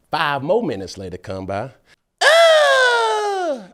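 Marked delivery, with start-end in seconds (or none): 2.2-3.7 mock yelp. Daughter yelling about brother.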